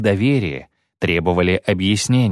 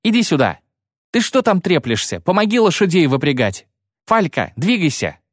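1 calling out; 8 semitones higher than usual. Acted. Iskra calling Kayley over.

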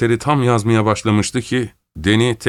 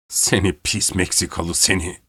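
angry yell; 3 dB quieter than usual. A narrator's reading. Danny to Rivera in his fury, mild angry yell. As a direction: spit out the insult with held-back anger. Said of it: "diye bağırdı".

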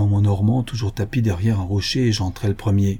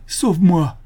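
high, louder plea for help; crying out in distress; 8 semitones higher, 4 dB louder, slower than usual. A narrator's reading. Daughter begs her father to save her, crying out. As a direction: plead urgently, pitch thrown up. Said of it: "cria-t-elle".

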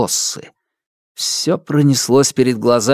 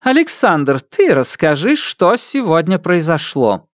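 imperious empress; 6 semitones higher than usual. A narrator's reading. Empress commands grandson.

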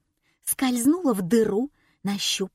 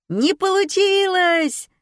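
much higher, loud, slow gloating cry; gleeful crowd; 11 semitones higher, 6 dB louder, slower than usual. A narrator's reading. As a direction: crow it out delightedly. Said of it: "обрадовались зрители".

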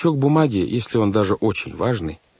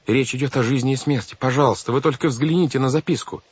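higher-pitched earnest appeal; beg, with voice pitched up; pleading; 6 semitones higher than usual. A narrator's reading. Girl begs banker to read the note, pleading. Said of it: "умоляла мистера По Вайолет".

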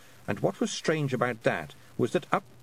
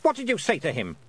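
snapping queen; 6 semitones higher than usual. A narrator's reading. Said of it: "snapped the Queen".

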